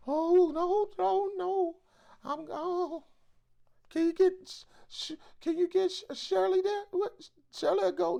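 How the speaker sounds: very high falsetto, flustered and dodging; mock timid worker. Organizer mocking a worker who pushes the rally onto Shirley, high flustered falsetto. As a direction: squeal it out like a scared coworker passing the buck.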